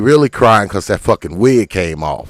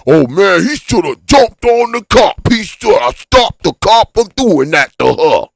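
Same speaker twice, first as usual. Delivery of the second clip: higher, louder mock outrage; mocking imitation; 9 semitones higher, 6 dB louder than usual. Comic mocking the crowd, higher and louder mock outrage. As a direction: ham up the armchair hero's indignation. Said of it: "stupid ass Negroes be like".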